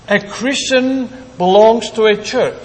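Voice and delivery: usual speaking voice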